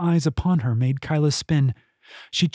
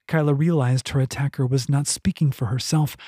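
plain reading voice

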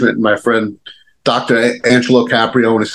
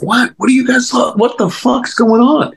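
shocked; 12 semitones higher than usual. Retold or imitated. Retold shocked reaction, pitch high.

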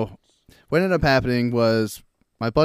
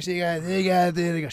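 higher-pitched mocking drunk babble; drunk woman; 5 semitones higher than usual. Guy mimicking the drunk chick at the party, mocking. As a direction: imitate a drunk woman stumbling over her words. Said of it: "she's drunk and all this shit".

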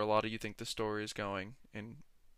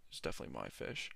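low, soft, quick aside; muttered aside; 10 semitones lower, 11 dB quieter, faster than usual. Real, not acted. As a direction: mutter it under your breath.

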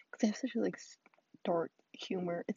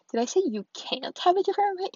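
loud, fast, high indignant comeback; indignant retort; 10 semitones higher, 8 dB louder, faster than usual. Retold or imitated. Snapping back at someone who condescended in a debate, loud and indignant. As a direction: fire it back, offended.

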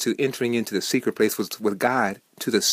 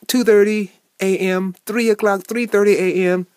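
higher, louder, incredulous listing of times; exasperated at the hour; 6 semitones higher, 5 dB louder than usual. Retold or imitated. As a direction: complain about being woken that early. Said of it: "I'm like".

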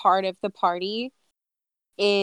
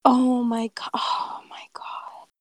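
breathless unvoiced whisper; shocked whisper; 4 semitones higher, slower than usual. Real, not acted. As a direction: whisper it in disbelief. Reacting to a tweet.

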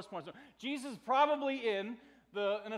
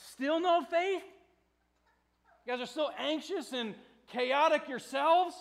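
louder, higher, incredulous questioning; stern rebuke; 7 semitones higher, 8 dB louder than usual. Acted. Jesus challenging his anxious disciples, stern. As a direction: press both questions as a reproach. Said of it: "but mild rebuke".

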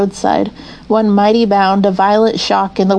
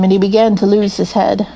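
usual speaking voice

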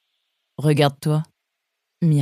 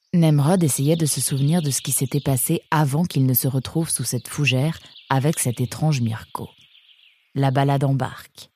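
ordinary delivery

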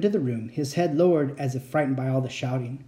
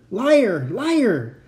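furious screaming, higher and louder; shaking with anger; 7 semitones higher, 4 dB louder, slower than usual. A narrator's reading. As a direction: scream it, trembling with rage. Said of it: "Uncle Victor screamed, shaking with rage".

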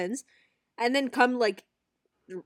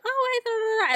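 very high, gushing hello; sky-high babbling greeting; mock friendly greeting; 14 semitones higher than usual. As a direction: imitate an over-friendly chirp. Imitating friend's over-friendly greeting.

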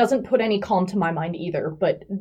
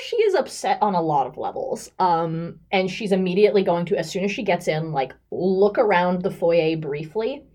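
ordinary talk

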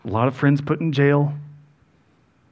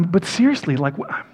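normal talking voice

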